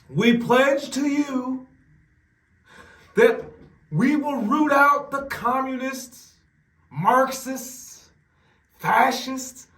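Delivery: high, loud, slow Trump impression; imitating Trump. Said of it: "That's how he talks".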